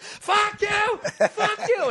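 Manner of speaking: very high, loud mock scream; shrieking impression of a hopping-mad heckler; imitated heckler scream. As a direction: scream it at the top of the range like an enraged heckler.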